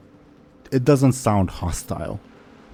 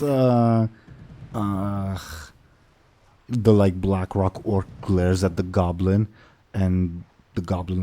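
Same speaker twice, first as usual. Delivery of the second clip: usual speaking voice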